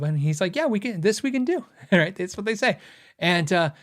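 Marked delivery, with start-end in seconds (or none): 0.6-1.6 higher, bright eager salesman voice; chirpy can-do rep; cheerful factory rep catchphrase; Warwick staff impression. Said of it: "I love doing this impersonation".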